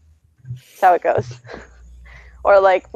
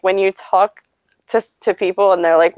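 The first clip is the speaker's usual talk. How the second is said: ordinary talk, nothing marked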